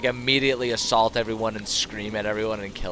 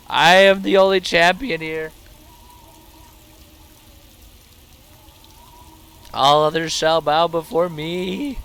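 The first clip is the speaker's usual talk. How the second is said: hammed-up conqueror, high and loud; mock-proclaim like a tyrant; dark lord; 7 semitones higher, 8 dB louder than usual. Gloating over slain dragon.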